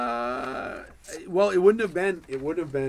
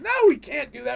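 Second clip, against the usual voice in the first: high, loud mock denial; sarcastic refusal; 11 semitones higher, 8 dB louder than usual. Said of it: "you don't get sarcasm at all".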